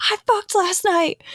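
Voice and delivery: excited friend. Retold or imitated. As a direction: play the friend bursting with news. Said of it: "she was like".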